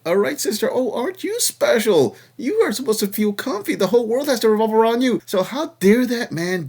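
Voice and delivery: high, mocking sing-song; sarcastic mockery. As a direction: talk her down with fake awe. Sarcastic mock to the caller.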